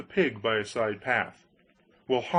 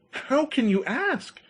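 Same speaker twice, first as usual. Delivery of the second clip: high, slow, delighted; can't believe his luck; excited Hans; 13 semitones higher than usual. Acted.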